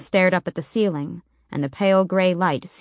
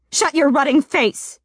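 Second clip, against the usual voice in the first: sharp furious shout, much higher and louder; snapped; 8 semitones higher, 4 dB louder than usual. A narrator's reading. Selena snapping at the knocker before storming off, furious shout. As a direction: bite it off in a rage. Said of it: "she snapped".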